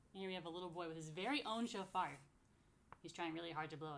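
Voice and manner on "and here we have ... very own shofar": usual speaking voice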